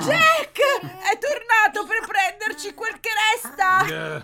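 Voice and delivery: high, loud cry of alarm; alarmed shout. Acted. Raising alarm at Jack's return. (grido acuto e allarmato)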